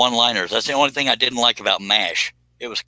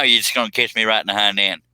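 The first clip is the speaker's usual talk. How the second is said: normal talking voice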